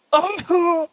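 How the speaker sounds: high-pitched acted yelps of pain; short high cries of pain. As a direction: yelp high, as if being hurt. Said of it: "pained yelps".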